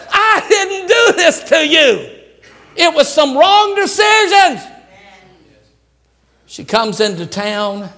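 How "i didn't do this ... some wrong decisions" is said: high, shouted divine rebuke; voice of God. Acted. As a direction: boom it down like God correcting her. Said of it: "God could have said".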